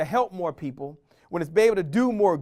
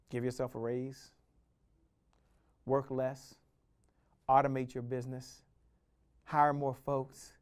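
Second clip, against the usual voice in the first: soft intimate list; 6 semitones lower, 9 dB quieter than usual. Acted.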